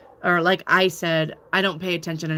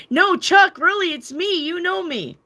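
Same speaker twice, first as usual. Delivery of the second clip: high, loud, overeager insisting to a stranger; mock frantic dream-stranger plea; pretend excited pleading; 11 semitones higher, 5 dB louder than usual. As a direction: gush at him like he must remember you. Pestering Chuck about the dream.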